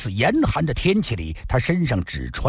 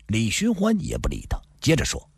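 ordinary delivery, nothing marked

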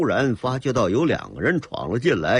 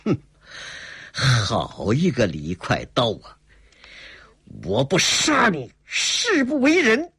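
cold sneer into vow; 2 semitones higher than usual. A narrator's reading. Swordsman vowing to kill the bandit, sneering fury. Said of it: "冷笑了一声".

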